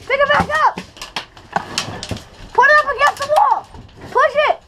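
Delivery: high, rushed urgent orders; urgent high commands. Real, not acted. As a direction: bark orders fast and high, hurried. Urgent orders under fire.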